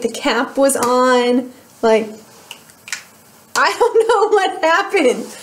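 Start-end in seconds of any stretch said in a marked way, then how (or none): 3.5-5.4 laughing in disbelief. Real, not acted. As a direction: laugh through the words. Laughs mid-sentence, puzzled.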